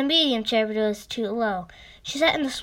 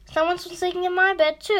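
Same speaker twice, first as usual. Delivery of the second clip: much higher, fast mother bear voice; mother bear exclaiming; 7 semitones higher, faster than usual. A narrator's reading. Mother bear finds her bed slept in, high exclaiming. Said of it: "exclaimed Mother Bear".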